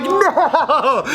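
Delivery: high drawn-out cry, half laughing; mock dismay. Man seeing the fight on video, dismayed. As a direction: act out comic horror. Horror at being recorded, retold.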